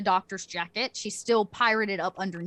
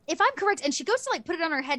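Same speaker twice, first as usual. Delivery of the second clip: a hint of a higher NPC voice; 8 semitones higher, much faster than usual. GM voices Lumara.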